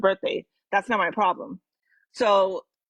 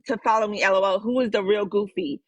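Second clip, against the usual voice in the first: ordinary delivery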